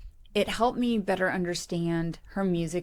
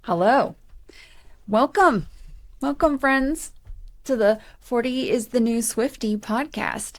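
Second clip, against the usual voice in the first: high, loud, sing-song welcome; kindergarten teacher voice; 5 semitones higher, 6 dB louder than usual. Acted. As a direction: greet them like a class of five-year-olds. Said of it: "You sound like a kindergarten teacher".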